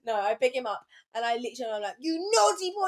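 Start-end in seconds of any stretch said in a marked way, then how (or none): 2.0-2.9 high-pitched telling-off; re-enacted cross scolding of a pet. Picking up the dog to tell him off, scolding.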